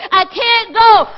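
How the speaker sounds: shout. Acted. Preacher shouting the final excuse of the congregation. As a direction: fling out the last excuse at full voice.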